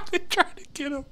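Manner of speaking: high cackle running into the words; laugh, then squeak it out; laughing hard. Real, not acted. Gamer amused they tried to get him, laughing.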